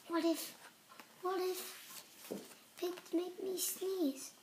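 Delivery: hushed, anxious realisation; worried hush. Acted. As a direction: murmur it in quiet dread.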